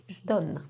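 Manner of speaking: "Je donne" said as zh-donne, no E after J; J runs straight into D; French "je" contraction; contracted je. Shown as a demonstration. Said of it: "il faut directement connecter le J et le D".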